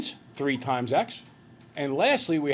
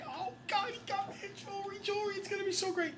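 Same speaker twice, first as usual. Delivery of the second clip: much higher, breathless gushing; gushing excitement; 9 dB quieter than usual. Retold or imitated. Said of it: "Annie M is so excited".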